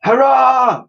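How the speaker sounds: high cheering shout. Acted. Ship's crew cheering at the bridge, loud shout. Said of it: "shouted the".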